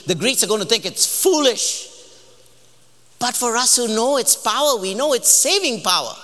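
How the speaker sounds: high, forceful sermon delivery; impassioned preaching. Real, not acted. Preacher urging church not to fear philosophers, impassioned. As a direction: proclaim it with rising conviction.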